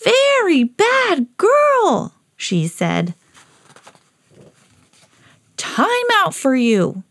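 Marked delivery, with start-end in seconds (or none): 0.0-2.2 high, very slow telling-off; drawn-out storybook reprimand; scolding. Acted. Owner scolds dog after mishap. 5.6-7.0 slow, higher-pitched reprimand; storybook telling-off, slow and firm; stern scolding. Acted. Owner punishes the dog.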